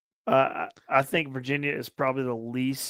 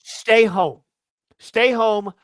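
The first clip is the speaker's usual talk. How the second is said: louder barked order to leave; angry yell; 5 semitones higher, 6 dB louder, much slower than usual. Retold or imitated. Podcaster ranting at a rude fan, loud angry order. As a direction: tell him off, loud and final. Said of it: "let me go on a little rant here".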